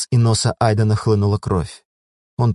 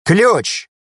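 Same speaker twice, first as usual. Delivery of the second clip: one loud, high barked word; barked demand; 11 semitones higher, 4 dB louder than usual. A narrator's reading. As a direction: snap the demand out hard. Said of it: "тут же добавил".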